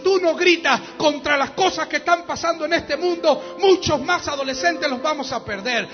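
rising to a shouted sermon climax; fiery preaching. Acted.

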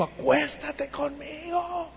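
high, drawn-out soft wail; desperate seductress. Acted. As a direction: plead in a stretched, high, hushed howl. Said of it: "ya estaba desesperada". (súplica desesperada, aguda y alargada)

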